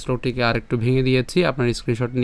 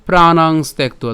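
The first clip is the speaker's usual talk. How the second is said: slow demo recitation; 4 dB louder, slower than usual. Shown as a demonstration. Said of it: "তাহলে".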